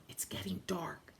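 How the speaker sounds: hushed, breathy whisper. Acted. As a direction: whisper it like a scared child. Irene frightened in darkening snow.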